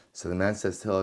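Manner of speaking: ordinary delivery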